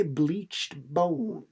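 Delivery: ordinary delivery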